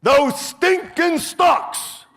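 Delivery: high, slow, loud disgusted cry; dad's nightly loud grumble at the stocks; disgusted outburst. Retold or imitated. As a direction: spit it out like a fed-up father reading the paper. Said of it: "He'd go like this".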